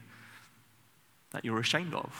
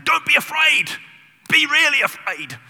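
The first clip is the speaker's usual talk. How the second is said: much higher and louder, a heated yell; mock shout; 14 semitones higher, 12 dB louder than usual. Moses shouting at the frightened people like an argument. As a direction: bellow it like a heated row. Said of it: "It seems a bit like that".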